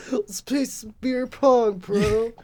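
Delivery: high, slow party bro; frat bro. Acted. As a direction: drawl it like a goofy bro. Voicing zombie frat boy.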